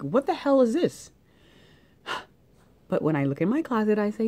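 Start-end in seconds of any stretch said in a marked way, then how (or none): 0.0-1.1 high, loud mock outrage; hammed-up indignant cry; shocked at the bill. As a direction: react to a bill in disbelief. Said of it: "credit card bill and you're like".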